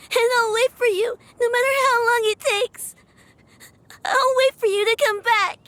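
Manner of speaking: high, tearful, sobbing vow; crying promise. Acted. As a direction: hold back sobs as you promise. Tearful farewell promise to granny.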